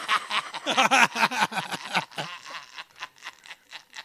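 long loud burst of laughter. Real, not acted. Laughing at insult.

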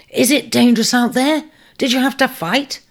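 put-on high, eager young ram asking; eager question. A narrator's reading. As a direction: press him for tales. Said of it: "urged one".

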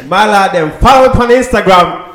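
light Jamaican patois; Jamaican accent. Pretend. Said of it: "You should say it in your Jamaican accent".

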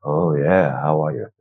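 deep, low-pitched greeting; going deep. Shown as a demonstration. Coach showing he can still go deep, low voice. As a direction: drop the voice right down low. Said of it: "I can still go deep".

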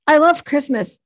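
normal talking voice

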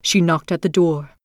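ordinary delivery, nothing marked

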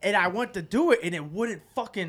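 normal talking voice

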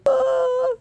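mock crying wail. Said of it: "I was like".

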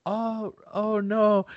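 slow, high mock "oh no"; impression of friend. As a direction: play the flustered friend, drawn out. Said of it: "that's a great impression of you".

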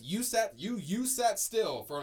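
high, loud incredulous imitation; mock disbelief. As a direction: imitate people stunned by the news, drawing it out. People's disbelief, acted out.

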